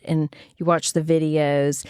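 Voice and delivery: ordinary delivery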